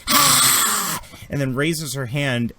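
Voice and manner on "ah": high, breathy drawn-out screech; villainess's shriek. Acted. Game master plays the spore woman being fired at, high screech. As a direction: let out a high hissing scream. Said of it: "and she goes".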